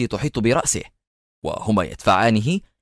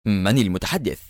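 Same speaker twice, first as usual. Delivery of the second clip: sharp; 3 semitones lower than usual. A narrator's reading. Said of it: "رفع سماعة الهاتف وقال في حدة".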